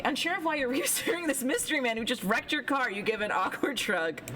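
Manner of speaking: ordinary delivery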